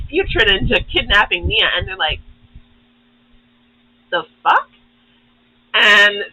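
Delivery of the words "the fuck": high startled the fuck; mock surprised. Past ninja seeing future selves, high startled yelp.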